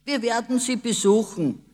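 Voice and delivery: loud, high eager declaration; father calling out, high and loud. A narrator's reading. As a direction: announce it loudly, full of mischief. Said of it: "rief der Vater".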